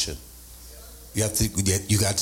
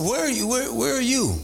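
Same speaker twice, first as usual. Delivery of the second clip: mock calling out; 11 semitones higher than usual. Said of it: "but I'm saying".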